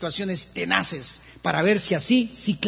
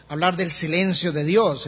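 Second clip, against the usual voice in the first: usual speaking voice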